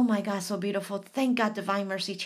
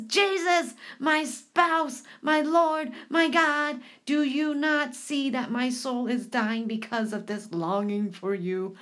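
anguished prayer. Acted. Acted prayer to Jesus.

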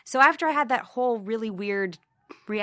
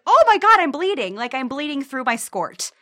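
mock panic; 3 semitones higher, 7 dB louder than usual. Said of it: "I'd be like".

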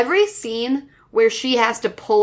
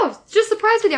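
mock perky; 10 semitones higher, faster than usual. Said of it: "And it's like".

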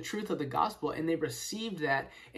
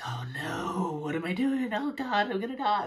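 mock panic; 3 semitones higher than usual. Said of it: "feeling of condemnation".

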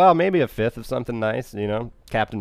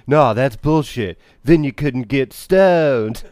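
slow, louder laid-back drawl; mock stoner; 2 semitones higher, 6 dB louder, much slower than usual. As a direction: lazily wave it off. Quoting stoner reply.

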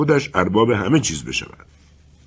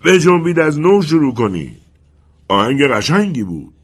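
much higher and louder, fading at the end; loud call; 7 semitones higher, 6 dB louder than usual. Acted. Colonel calls loudly to the wind to start again. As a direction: call out to the wind, commanding then softening. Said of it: "با صدایی بلند گفت".

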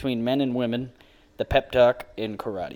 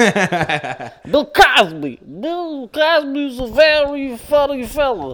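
much higher, slow put-on comedian voice; mock Cosby voice; 11 semitones higher, 4 dB louder, much slower than usual. Reading the Cosby record cover, goofy high comedian voice.

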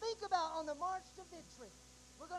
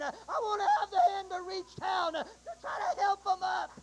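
full-force preaching; 3 semitones higher, 10 dB louder than usual. Acted. Preacher urging the congregation to hang on, shouting. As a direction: lift the congregation with hope, crying out.

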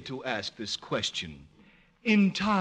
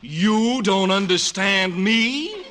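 higher, louder, drawn-out disbelief; indignant echo; 6 semitones higher, 7 dB louder, slower than usual. Acted. Clerk echoes the guest's words back in loud disbelief. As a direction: throw the question back, scandalised.

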